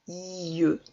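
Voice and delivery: "I E" as one sound, long i into eu; drawn-out I. Shown as a demonstration. Teacher answering how I E U sounds, i drawn out. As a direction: hold the I, glide into E as eu. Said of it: "comment ça se prononce".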